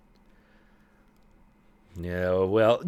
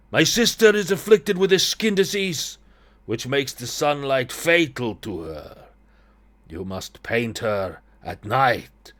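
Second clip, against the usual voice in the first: put-on Eastern European accent, strong start slowing to the end; Ilya, Eastern European accent; 3 semitones higher, 3 dB louder, slower than usual. Brother explains his sister's affliction, Eastern European accent. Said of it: "I don't know what accent I'm doing here".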